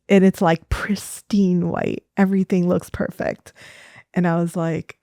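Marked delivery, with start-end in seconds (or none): none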